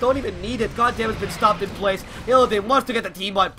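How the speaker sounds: loud, building esports shout-cast; excited play-by-play. Acted. Live play-by-play of a teamfight.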